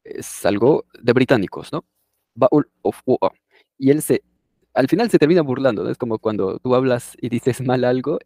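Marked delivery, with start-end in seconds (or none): 2.3-3.4 "Bowl of water" with T's swallowed, "baul of wo-a"; glottal stop. Shown as a demonstration. Teacher mimics the British glottal stop for students. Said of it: "ya no dicen bottle, sino baul".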